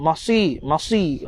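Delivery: "masir" with heavy s; thick sad. Shown as a demonstration. Teacher drills the letter, "masir" with heavy emphatic s. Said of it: "Sad tebalkan dia".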